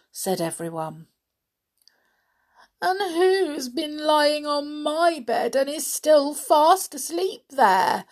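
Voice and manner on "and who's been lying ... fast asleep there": put-on high, slow childish complaint; baby bear. A narrator's reading. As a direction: pipe it up high as an affronted little bear. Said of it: "said the baby bear".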